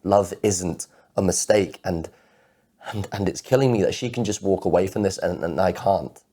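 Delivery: loud, impassioned outpouring; anguished protest. Acted. Man protesting that love isn't a mistake, anguished and loud.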